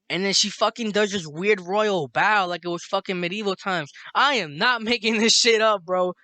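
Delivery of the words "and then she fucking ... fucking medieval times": plain reading voice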